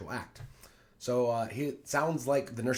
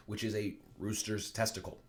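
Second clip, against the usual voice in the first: usual speaking voice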